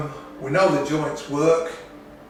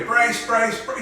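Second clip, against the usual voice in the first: raised crew brace call; crew shouting brace; 7 semitones higher, slower than usual. Retold or imitated. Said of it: "Is that what they do?".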